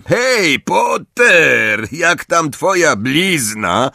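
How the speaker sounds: high, drawn-out jeering call; taunting shout. A narrator's reading. Malfoy heckling Potter about his scar, shouted high. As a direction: shout a taunt across the pitch. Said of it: "krzyknął Malfoy".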